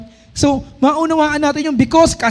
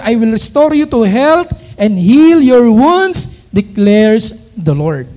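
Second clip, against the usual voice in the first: slow, loud, solemn verse reading; scripture reading; 4 dB louder, slower than usual. Acted. Preacher proclaiming a verse to the congregation, slow and loud. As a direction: proclaim each word of the verse slowly.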